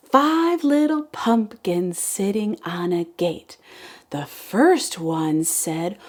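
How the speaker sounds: slow, sing-song poem reading; kindergarten teacher voice. Acted. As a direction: read it like circle time. Reads poem again.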